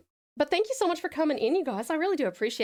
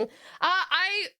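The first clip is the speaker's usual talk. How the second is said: ordinary delivery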